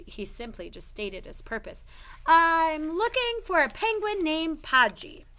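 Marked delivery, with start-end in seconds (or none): 2.3-5.2 put-on boy character voice. Noah states his purpose in character.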